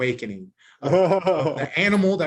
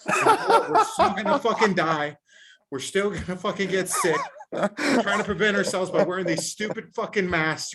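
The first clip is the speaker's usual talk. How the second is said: high, laughing through the words; laughing, half-shouted rant; 5 semitones higher, 4 dB louder than usual. Real, not acted. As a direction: rant while cracking up. Ranting while laughing.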